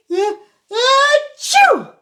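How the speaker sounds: loud, high, hammed-up fake sneeze; big pretend sneeze. Storyteller showing kids a sneeze, big hammed-up achoo. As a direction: throw out a huge mock sneeze. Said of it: "big pretend sneeze".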